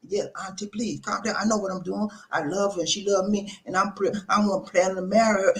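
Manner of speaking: calm her down and plead your case; gently protesting nephew. Acted. Nephew pleads with upset auntie.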